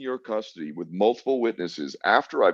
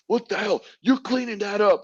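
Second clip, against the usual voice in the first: much higher, mock outraged guard; imitating an angry guard. Guard scolding the prisoner over spilled food, mock outraged. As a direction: squeal it like the furious guard.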